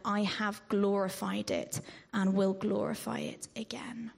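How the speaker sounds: ordinary talk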